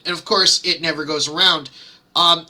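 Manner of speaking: usual speaking voice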